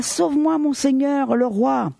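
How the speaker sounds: high, pleading cry for help; crying out to the king. A narrator's reading. A starving woman calls up to the king, high pleading cry. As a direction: beg the king to save you. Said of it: "une femme lui cria".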